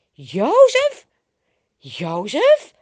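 loud, high-rising incredulous question; disbelief. A narrator's reading. The slaves see Jozef's cloak and cannot believe it, astonished. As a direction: question the name, astounded.

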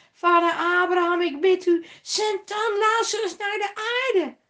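loud, high, hurried pleading; urgent high loud plea to Abraham; rich man insisting. A narrator's reading. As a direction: beg for his five brothers. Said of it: "hield de rijke man aan".

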